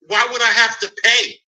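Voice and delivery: indignant. Retold or imitated. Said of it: "I said".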